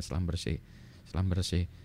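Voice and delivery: Schlumberger said softly, shlum-ber-ZHAY; correct reading. Shown as a demonstration. Lecturer shows the proper reading of Schlumberger, shlum-ber-ZHAY. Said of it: "katanya bacanya".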